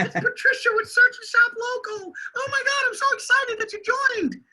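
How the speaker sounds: very high, gushing greeting, hammed up; over-the-top excited receptionist; Patricia voice. Recording a voicemail greeting as Patricia, high and gushing. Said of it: "this is going to be for Patricia".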